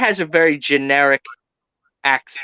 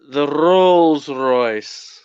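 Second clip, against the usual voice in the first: very slow, lofty mock-posh "the Rolls Royce"; 2 semitones lower, much slower than usual. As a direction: savour each word like a snob showing off. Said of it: "He exaggerates the A so much".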